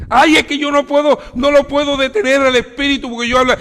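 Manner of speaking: high, loud whining congregant protesting; high pleading excuse from a churchgoer; whiny excuse. Retold or imitated. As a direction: play the member who pleads he can't stop. Congregant excusing himself to pastor.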